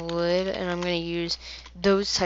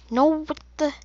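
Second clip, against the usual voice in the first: high, loud what the; sudden squeaky dismay; shocked high cry; 10 semitones higher, 6 dB louder than usual. Real, not acted. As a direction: caught off guard by a miss. Misses shot.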